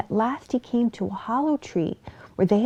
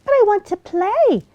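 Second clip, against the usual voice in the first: high, squeaky little fox pleading; small fox begging the bear to play, high and squeaky; 14 semitones higher, 6 dB louder than usual. Acted. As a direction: whine to be allowed to play. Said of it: "said Figgy Two Socks".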